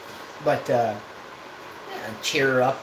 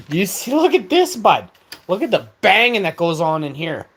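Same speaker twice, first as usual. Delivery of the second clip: loud bragging; 4 semitones higher, 7 dB louder than usual. Acted. Bragging loudly to chat.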